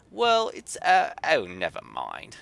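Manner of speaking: a cross Yorkshire artificer grumbling; Yorkshire grumble. Acted. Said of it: "in a thick Yorkshire accent".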